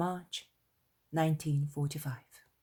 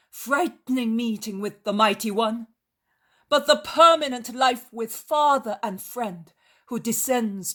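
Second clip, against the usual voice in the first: loud, raised storyteller's oratory; exalted proclamation; 7 semitones higher, 10 dB louder than usual. Acted. Jesus speaking to the crowd of joy with the Father, exalted proclamation. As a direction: declaim it solemnly to a crowd. Acted sermon by a character.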